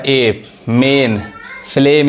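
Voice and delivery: ए and मेन drawn out slowly, then फ्लेम, each with a clear ए vowel; teacher slowly modelling ए, मेन, फ्लेम with a pure ए vowel; slow model pronunciation. Shown as a demonstration. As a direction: spell it out slowly: ए, मेन, फ्लेम, stretching the ए sound. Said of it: "यही ए क्या पढ़ रहा है?".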